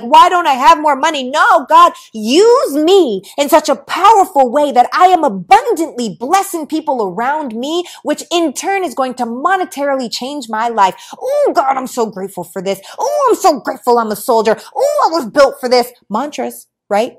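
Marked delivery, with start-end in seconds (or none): 0.0-1.2 higher, louder mock complaint; mock crying. Speaker showing how not to pray about money, mock-crying. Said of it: "I'm not gonna cry and be like". 1.2-10.9 loud, high-pitched impassioned plea to God; fervent prayer. Acted. Speaker asking God to use her powerfully, fervent prayer. 11.2-15.9 loud, high "oh" launching each thankful cry; fervent gratitude. Acted. Speaker modelling grateful mantras to God, fervently thankful.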